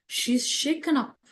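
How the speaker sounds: usual speaking voice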